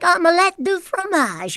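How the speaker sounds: put-on high voice, light French accent; slightly French. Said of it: "it was slightly French".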